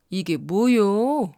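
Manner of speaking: very slow, unhurried Chungcheong drawl; slow Chungcheong dialect. Shown as a demonstration. Narrator showing learners the slow Chungcheong pace, drawn-out Chungcheong accent. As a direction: take your time, Chungcheong style. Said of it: "말의 속도가 느린 편이다".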